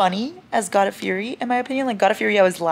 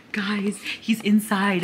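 whisper; 2 semitones higher, much slower than usual. Real, not acted. Cat asleep in tunnel bed.

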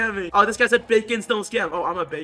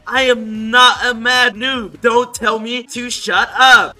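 slow, loud, high protest; mock outrage; 4 semitones higher, 9 dB louder, slower than usual. Reading the mad noob's retort, mock outrage. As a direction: hammer each word out in mock anger. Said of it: "I'm just gonna make fun of him".

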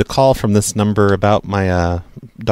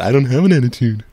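mocking imitation. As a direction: mimic her prissy reply. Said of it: "That's what you sounded like".

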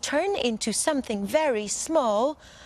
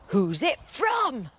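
giant wondering; 5 semitones higher, slower than usual. Acted. Giant wonders about card.

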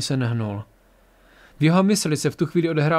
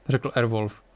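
ordinary delivery

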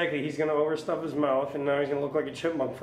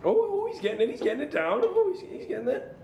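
excited; 12 semitones higher than usual. Real, not acted. Cheering friend swallowing food.

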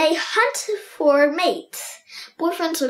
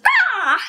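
short higher squawk; 8 semitones higher, 3 dB louder than usual. Shown as a demonstration. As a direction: let out a quick squawk. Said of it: "or squawks".

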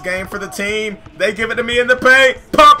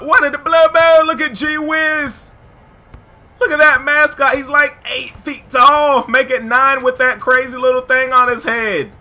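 higher-pitched, stunned play-by-play shout; amazed hype; 5 semitones higher, 4 dB louder than usual. Real, not acted. Player spots a giant mascot at the park, amazed hype. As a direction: gawk out loud at a giant.

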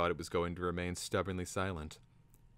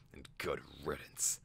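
softer, lower bitter aside; 3 semitones lower, 5 dB quieter than usual. A narrator's reading. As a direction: add it bitterly, dropping away. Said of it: "he added bitterly".

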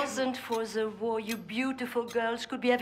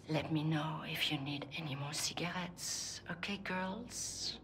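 much softer, hushed and sly; conspiratorial whisper; 6 semitones lower, 10 dB quieter than usual. Acted. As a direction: lean in and whisper the offer. Conspiratorial offer to girls.

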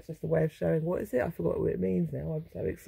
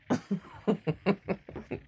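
laugh; 3 semitones higher than usual. Real, not acted. After calling father an Italian barrister, chuckling laugh. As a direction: run of chuckles.

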